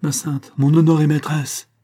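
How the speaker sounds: cried out high and loud; a loud loyal outcry; fervent cry. A narrator's reading. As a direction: burst out in devotion to his queen. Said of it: "s'écria Dimitri".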